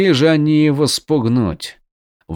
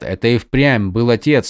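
ordinary delivery

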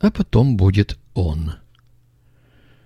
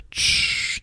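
shushing; 6 dB quieter than usual. Acted. Приказ молчать.

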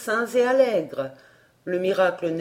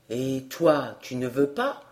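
much lower, softer countryman; calm peasant; 8 semitones lower, 4 dB quieter than usual. A narrator's reading. Peasant to Emmy, much lower and softer. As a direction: ask him quietly like an old farmer.

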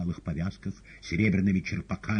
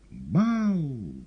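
high, loud feline shriek; shrill cat yelp; piercing cat squeal; 9 semitones higher, 5 dB louder than usual. A narrator's reading. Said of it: "пронзительно взвизгнул кот".

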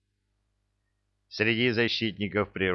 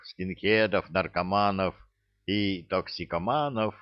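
ordinary delivery

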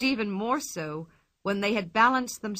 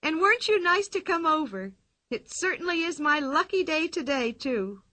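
put-on higher, bright teasing girl; gleeful Pippi; 6 semitones higher than usual. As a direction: tease the policemen from the roof, higher. Pippi voiced by narrator.

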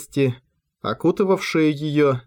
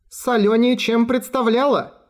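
exclaiming; 8 semitones higher than usual. Acted. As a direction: exclaim after the dunking. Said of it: "воскликнула Чайка".

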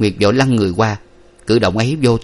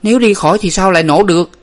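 high, loud irritated retort; exasperated big sister; annoyed; 10 semitones higher, 5 dB louder, faster than usual. A narrator's reading. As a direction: throw the question back crossly. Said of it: "Nguyệt bực tức nói".

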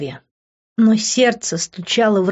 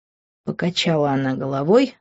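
ordinary delivery

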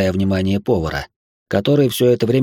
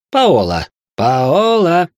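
louder, second cry rising high; startled exclamation; 8 semitones higher, 5 dB louder, much slower than usual. A narrator's reading. To the weeping woman at his feet, loud exclamation. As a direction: exclaim the name twice, drawing it out higher.

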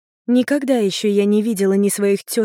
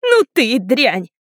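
slow, loud, high squeal of disgust; 4 semitones higher, 5 dB louder than usual. A narrator's reading. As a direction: squeal the insult, drawing it out. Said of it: "завизжала сестрица". (визгливо, с отвращением)

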